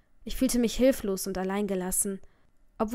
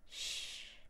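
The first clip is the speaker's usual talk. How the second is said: soothing shush; 18 dB quieter than usual. Acted. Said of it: "versuchte Jaden mich zu beruhigen".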